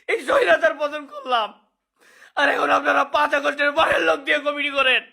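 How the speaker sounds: weeping, voice breaking; tearful outburst of grievance; snotty crying. Real, not acted. Crying while complaining.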